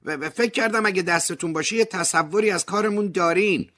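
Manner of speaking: high, loud squeal down the line; shrieking journalist. A narrator's reading. Journalist squeals at Ove down the line, high and loud. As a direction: shriek it at him through the phone. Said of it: "از پشت خط جیغ زد".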